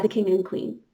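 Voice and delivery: usual speaking voice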